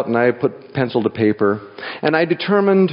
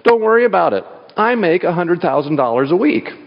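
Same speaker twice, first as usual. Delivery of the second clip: calm unbothered; 7 semitones higher than usual. Retold or imitated. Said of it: "very calmly just kicks back".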